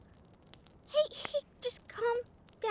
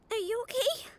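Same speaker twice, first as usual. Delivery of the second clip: ordinary talk, nothing marked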